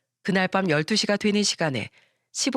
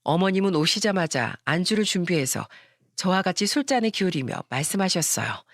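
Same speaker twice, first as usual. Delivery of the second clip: ordinary talk, nothing marked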